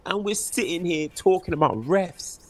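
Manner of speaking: usual speaking voice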